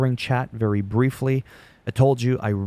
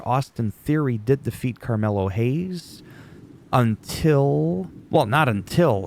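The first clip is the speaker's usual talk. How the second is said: ordinary delivery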